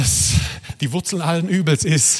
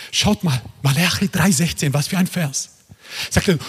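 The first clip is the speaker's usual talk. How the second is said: usual speaking voice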